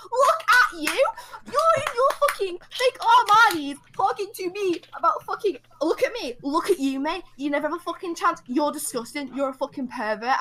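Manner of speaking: high, loud mocking rant at a stranger; mock furious yelling. Woman retelling how she yelled at a train pervert, furious and high. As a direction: hurl it back at him, incredulous and furious. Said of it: "calling him a pervert as loud as I can".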